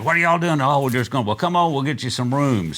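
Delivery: high, loud, delighted hello; calling out across the room, glad and surprised; surprised greeting. Retold or imitated. Willie greeting the band in Vegas, retold with loud surprise.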